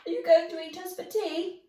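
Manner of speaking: put-on high, loud, fast panicky boy; panicked. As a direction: blurt the fear of being eaten. George fears being eaten.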